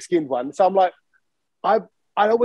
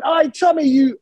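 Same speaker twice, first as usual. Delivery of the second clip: retold heckle; 9 semitones higher, 5 dB louder, slower than usual. Retold drunk insult shouted at him.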